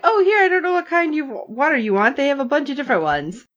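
lightly acted eager hostess; chattering offer. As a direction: babble helpfully as the character. Voicing Waverly offering drinks.

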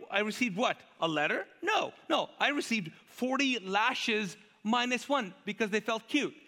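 high, slow, emphatic list of grievances; Paul's rant. Acted. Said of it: "Paul on a rant".

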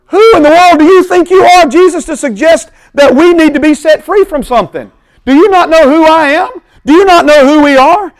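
loud, high, indignant tirade; hammed-up furious crowd; offended outrage. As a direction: rail at Jesus as the offended crowd. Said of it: "they became offended".

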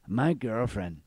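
lightly put-on Mexican accent. Customer airing girlfriend troubles to the bartender, mock Mexican accent. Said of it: "in this accent".

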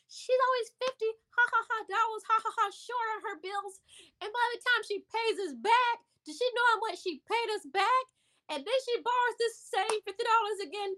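high, mocking voice broken by ha ha ha; high-pitched mock laughter of the friend; imitated hysterical laughter. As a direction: mimic her laughing through the punchline. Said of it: "she's laughing so hard till she's crying".

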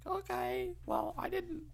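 mock high voice.

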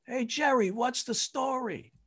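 hoog, spottend geroepen; high mocking call. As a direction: roep hem pesterig en hoog toe. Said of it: "Somebody yelled out".

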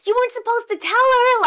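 very high, loud acted-out reproach; mad cousin. Cousin blames child for telling teacher, mad. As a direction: wail the complaint at full volume. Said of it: "my cousin is mad at me".

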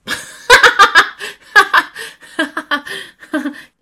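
big high burst of laughter; cracking up loudly. Real, not acted.

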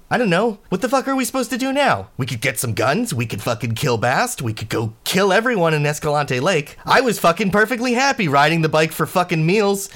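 furious high-pitched rant; angry yelling. Acted. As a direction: yell it, fed up and furious. New ranting at Kai.

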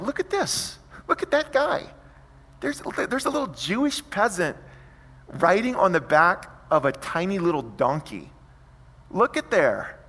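high, jeering voice pointing at the peasant; mocking soldier. Soldiers mocking the donkey procession, high jeering voice. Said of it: "and they're like".

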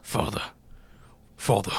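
low, soft British "Father"; British accent. Shown as a demonstration. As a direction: say "Father" softly as a put-on Brit. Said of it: "What do they say for father?".

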